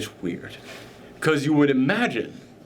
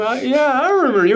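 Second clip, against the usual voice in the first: higher, louder stoned realization; delighted recognition; 8 semitones higher, 5 dB louder than usual. Acted. Tripping stunt double recognizes intruders, high excited voice. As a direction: let recognition dawn high and loud.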